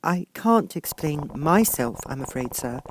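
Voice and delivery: high, lightly voiced girl; a young girl's apologetic voice; Alice. A narrator's reading. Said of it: "said Alice".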